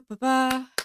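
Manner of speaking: normal talking voice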